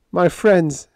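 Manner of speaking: a touch of choked emotion. Acted. Biggs breaking bad news to his friends, slightly choked. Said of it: "he said in a choked voice".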